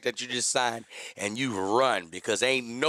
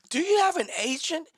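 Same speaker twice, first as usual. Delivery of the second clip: mock agent; 13 semitones higher than usual. Mocking scam agents.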